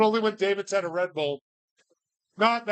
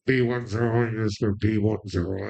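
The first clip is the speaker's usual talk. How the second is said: lower, mumbled club announcer call; stripper announcer voice; 9 semitones lower, much faster than usual. Pretend. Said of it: "like a stripper announcer".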